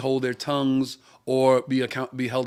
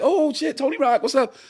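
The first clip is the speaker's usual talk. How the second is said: put-on much higher, squealing fan girl; excited fan girls; 12 semitones higher than usual. Girls at the counter spot the comedian, starstruck excited.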